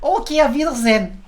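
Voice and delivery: hammed-up German accent for a farewell; super fake German accent. Shown as a demonstration. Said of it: "super fake German accent".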